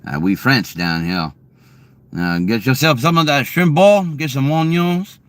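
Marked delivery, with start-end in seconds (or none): 2.4-5.2 higher-pitched Louisiana Cajun demo; Cajun accent. Shown as a demonstration. Showing chat Louisiana talk, high Cajun accent. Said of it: "Things like that".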